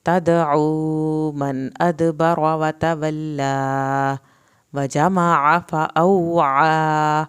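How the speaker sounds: slow, loud, drawn-out tajweed chant; Quran recitation. Shown as a demonstration. Teacher recites aloud for students, slow loud chant. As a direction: chant each word slowly for the class to follow. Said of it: "teacher models the recitation".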